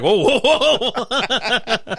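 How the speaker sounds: high, loud laughing whoa; hammed-up panic at someone getting out of the car; mock alarm. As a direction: pretend to back off in a hurry.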